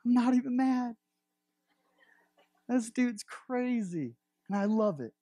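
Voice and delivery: high, loud, delighted disbelief, slowing on crazy; thrilled amazement. Acted. Jesus marvels at the man who tore his roof off, high and delighted. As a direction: gush like someone blown away by sheer nerve. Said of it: "Just like".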